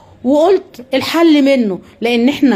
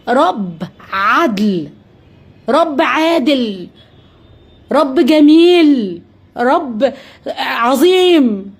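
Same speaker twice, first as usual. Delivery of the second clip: very slow, each word stretched apart; slow, reverent listing of God's names; slow reverent praise; much slower than usual. Acted. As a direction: savour every word in awe.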